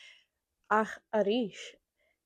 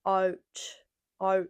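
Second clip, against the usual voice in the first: drawn-out "out" in an Irish accent; own Irish accent. Shown as a demonstration. Giving examples of hard words, Irish accent. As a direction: say "out" with a slow Irish vowel. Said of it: "hard to understand in my accent".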